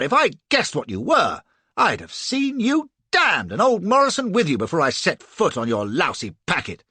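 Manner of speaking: loud, furious demand; angry outburst. A narrator's reading. Flashman furious at the captain for tricking him, loud angry demand. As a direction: rage at the captain, demanding shore.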